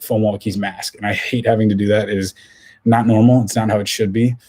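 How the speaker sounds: ordinary delivery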